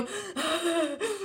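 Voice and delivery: high, strained mock childbirth gasp; acted-out gasp of someone in labour. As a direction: gasp as if giving birth. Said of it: "como que estuviese pariendo".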